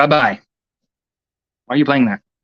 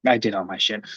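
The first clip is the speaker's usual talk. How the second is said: repeated much softer and lower, to himself; muttered; 5 semitones lower, 8 dB quieter than usual. Real, not acted. Streamer repeats it to himself, soft and low. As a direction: mutter it under your breath.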